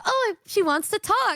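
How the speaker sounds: high cheerful. Retold or imitated. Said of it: "She was like".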